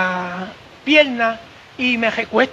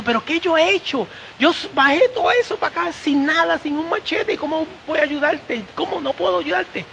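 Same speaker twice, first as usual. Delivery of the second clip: higher, anxious pleading questions; distressed; 6 semitones higher than usual. Retold or imitated. Helper kneeling beside injured Noel, anxious and higher. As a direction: fret that you have nothing to help with.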